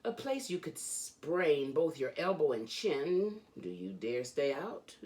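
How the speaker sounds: usual speaking voice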